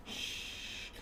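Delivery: breathy whispered shh; whispered shush. Retold or imitated. Creepy killer whispering shh at the complaining victims. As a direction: silence them, barely a breath.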